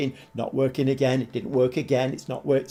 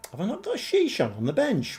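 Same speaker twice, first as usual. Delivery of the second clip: quick, higher, puzzled question; sudden doubt, asked fast; puzzled surprise; 9 semitones higher, 3 dB quieter, faster than usual. Real, not acted. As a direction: notice something odd in the squad. Spots missing player on bench.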